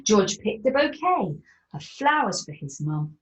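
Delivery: usual speaking voice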